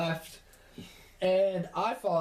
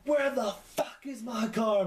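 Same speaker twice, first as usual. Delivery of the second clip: higher-pitched mock-furious demand; imitated angry mom; 7 semitones higher than usual. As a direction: act out an enraged mother bursting in. Retold furious mom bursting in.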